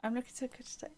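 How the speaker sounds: soft playful whisper. Real, not acted. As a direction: whisper it smugly. Whispered vain aside.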